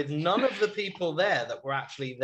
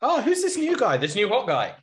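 high mock-gushing over the new guy; imitating a fan dazzled by the new hot guy; mock swooning fan; 6 semitones higher, 3 dB louder than usual. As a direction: gush like a smitten viewer, much higher. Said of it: "they've just forgotten he's C T".

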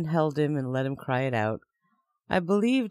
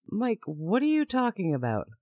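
confused question; 6 semitones higher than usual. Retold or imitated. Confused at Mike.

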